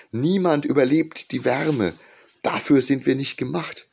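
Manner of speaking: high, loud warning of friends. A narrator's reading. Friends at home warn.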